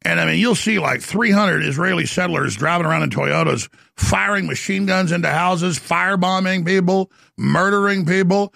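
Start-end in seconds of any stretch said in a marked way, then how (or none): none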